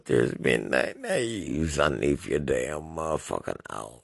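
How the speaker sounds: normal talking voice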